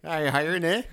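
put-on high, loud Canadian accent with a tacked-on eh. Joking about job hunting outside Brazzers, hammed-up Canadian accent. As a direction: lay on a cartoon Canadian accent. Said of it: "that's my Canadian accent".

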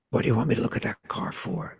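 unvoiced, hushed disbelief; whispered retort. Recalling a vision, he whispers back to God. Retold disgusted reply to God.

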